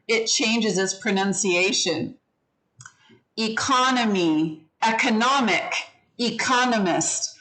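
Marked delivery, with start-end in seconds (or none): 3.4-7.3 economy and economist stressed on CON, economic on NOM; teacher contrasting economy, economic, economist. Shown as a demonstration. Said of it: "see how those all sound really different".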